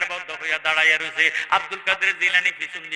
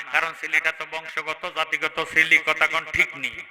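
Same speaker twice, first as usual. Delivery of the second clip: usual speaking voice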